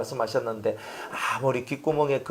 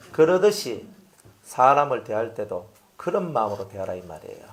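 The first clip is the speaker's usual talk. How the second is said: ordinary talk, nothing marked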